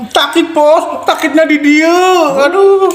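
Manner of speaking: high excited outcry. Real, not acted. Reacting to spicy mercon bakso.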